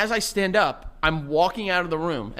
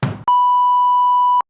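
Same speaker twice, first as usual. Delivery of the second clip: high, loud cackling laugh; loud burst of laughter; 10 dB louder than usual. Real, not acted. Laughing at his friend's mammal mix-up, loud high laugh. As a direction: crack up hard. Cracking up at Joey.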